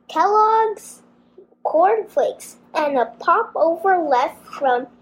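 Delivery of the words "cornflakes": ordinary delivery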